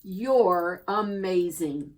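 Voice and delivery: usual speaking voice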